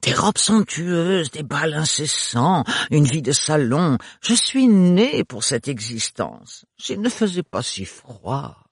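slow, drawn-out swooning voice; gushing, dreamy sister. Acted. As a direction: swoon over a life of luxury.

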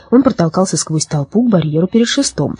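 ordinary delivery, nothing marked

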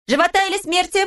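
put-on high, teasing little girl; mock-fierce victor's question; playful girl's challenge. A narrator's reading. Pippi demands surrender. (игривый вызов девочки)

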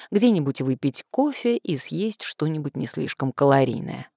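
ordinary delivery

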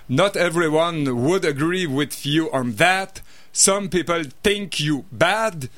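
français lisant de l'anglais, accent à couper au couteau; bad English accent. Shown as a demonstration. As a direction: read it with a clumsy Québécois English accent. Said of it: "mon accent anglais épouvantable". (accent anglais exprès maladroit)

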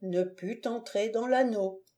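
plain reading voice

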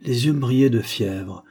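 usual speaking voice

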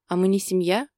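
ordinary delivery, nothing marked

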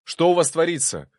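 high, loud demand; alarmed, loud questioning; yelling. A narrator's reading. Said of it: "Я заорал так".

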